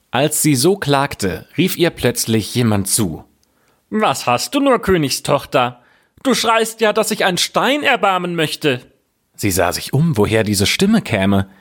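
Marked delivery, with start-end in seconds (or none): 3.9-8.8 high-pitched frog character voice. Acted. Frog calling to the weeping princess, high creature voice. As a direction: call out in a high, put-on frog voice.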